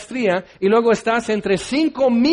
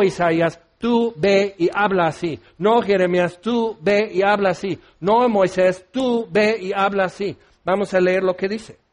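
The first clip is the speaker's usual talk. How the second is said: ordinary talk, nothing marked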